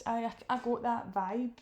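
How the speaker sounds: ordinary delivery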